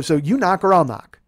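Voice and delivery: loud, insistent challenge; heated retold demand; worked-up ultimatum. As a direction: put the choice to him forcefully and loudly.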